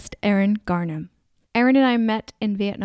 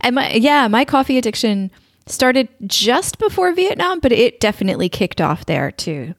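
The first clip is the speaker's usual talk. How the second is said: normal talking voice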